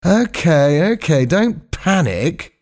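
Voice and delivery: high-pitched, drawn-out placating; slow, unhurried. A narrator's reading. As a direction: take your time as if nothing were urgent. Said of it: "said Skink slowly".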